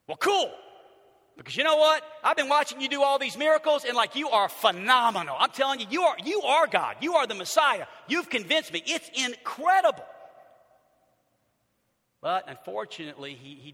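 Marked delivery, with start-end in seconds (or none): 0.1-10.0 loud, high, gushing praise; gushing fan. Pretend. Rich young ruler gushing over Jesus, loud and excited. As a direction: rave like an awestruck fan. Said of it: "what should the rich young ruler have said?".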